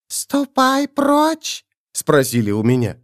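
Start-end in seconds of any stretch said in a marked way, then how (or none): 0.1-1.6 thin high creature, tasting the words slowly; very slow, high questioning hiss; whistling s. A narrator's reading. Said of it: "оказался тоненький, свистящий".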